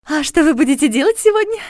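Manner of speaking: put-on high, excited girlish babble; enthusiastic babble. A narrator's reading. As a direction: gush it out eagerly. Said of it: "восторженно пролепетала она".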